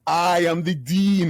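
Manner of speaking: slow, loud mock proclamation; mock shout. Dean mimicking the student shouting, slow and loud. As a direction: boom it out pompously. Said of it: "shouting at me".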